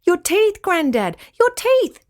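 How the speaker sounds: high alarmed cry of "Your teeth"; girls crying out. Acted. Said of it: "the girls cried".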